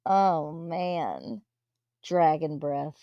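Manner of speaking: ordinary talk, nothing marked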